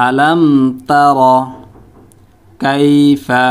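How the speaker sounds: slow, drawn-out words with long pauses between; teacher's word-by-word Quran demo; word-by-word recitation. Shown as a demonstration. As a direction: recite one Arabic word at a time, stretching long vowels. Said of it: "এখন উচ্চারণ করব শব্দ শব্দ".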